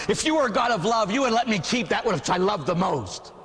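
high-pitched, impassioned reproach; indignant protest. Acted. Old man demanding God let him keep it, indignant. Old man's rebuke peaks.

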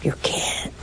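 hushed whisper. Acted. Preacher answering his own question, soft whisper. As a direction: breathe the answer out softly.

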